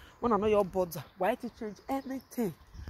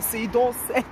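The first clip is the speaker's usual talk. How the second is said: ordinary talk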